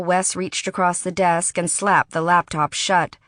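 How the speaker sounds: plain reading voice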